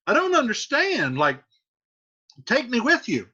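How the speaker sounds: high puzzled paraphrase; confused father. Retold or imitated. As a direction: play him baffled and high. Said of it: "So he's like".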